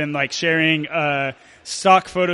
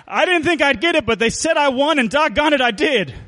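much higher, excited proud winner; exclaiming; 8 semitones higher than usual. A narrator's reading. Pa telling the family he won, exclaiming excitedly. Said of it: "Pa exclaimed".

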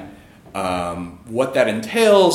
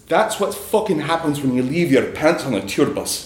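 higher, emphatic attempted Scottish accent; 5 semitones higher than usual. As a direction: imitate a Scottish buddy driving home a scolding. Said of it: "try to do a Scottish accent here".

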